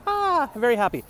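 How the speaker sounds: high, beaming fish voice; happy cartoon fish. Vlogger voices the logo fish, high and beaming. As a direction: squeak it out like a grinning cartoon fish.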